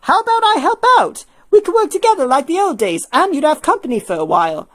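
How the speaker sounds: bright offer to help out; Alpha voiced. Alpha offering to help Hilda with paperwork, eager.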